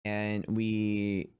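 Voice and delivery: ordinary delivery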